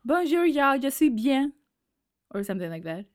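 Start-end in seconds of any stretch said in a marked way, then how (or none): 0.0-1.5 high, loud Southern-twanged French; hammed-up Southern French greeting; double Southern accent. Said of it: "double Southern accent".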